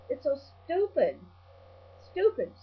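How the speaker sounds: ordinary talk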